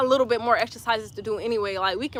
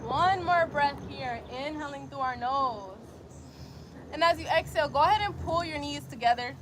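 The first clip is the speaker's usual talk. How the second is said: calm, even instructor voice; guided yoga; 11 dB quieter than usual. Acted. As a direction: coax them gently into frog pose. Leads frog pose sequence.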